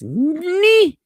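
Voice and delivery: high-pitched, loud Monty Python knight; Knight who says Ni. Acted. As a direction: shriek it like an absurd knight, drawn out. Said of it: "We are the knights who say ni".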